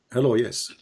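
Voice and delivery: ordinary talk, nothing marked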